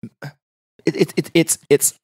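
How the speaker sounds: halting, mildly flustered stammer. Retold or imitated. Retelling who had called, flustered. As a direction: stumble over the first word, then get it out. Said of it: "She was all flustered".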